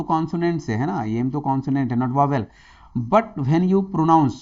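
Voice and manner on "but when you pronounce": slow, loud teacher explanation; stretched-out classroom emphasis; slow explaining. Shown as a demonstration. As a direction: spell it out slowly and loudly for the class. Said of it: "जब आप एम कहते हैं".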